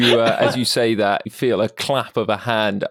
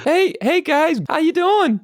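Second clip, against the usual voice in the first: high, eager pig character voice; friendly little pig. Acted. Little pig greets the party, eager high voice. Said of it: "It's a little pig".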